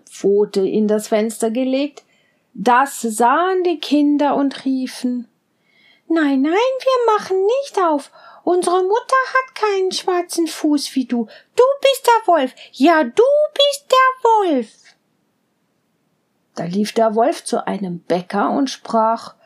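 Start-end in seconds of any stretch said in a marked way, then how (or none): 6.1-14.7 put-on high, bleating kid-goat voices; little goat kids. Little goats promising their mother to be careful, high and bleating. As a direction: chirp it high like tiny goats.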